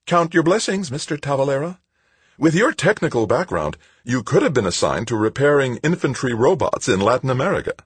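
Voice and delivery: very high-pitched, sugary lecturing; patronizing sweetness. A narrator's reading. Woman telling Tavalera to be grateful, patronizing. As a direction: chide him sweetly in a high voice. Smiling official lectures him.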